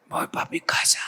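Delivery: whispered imitation of a lost voice; sick man croaking out a phone reply; voiceless pastor. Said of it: "¿Pero por qué hablas así?". (susurro afónico imitado)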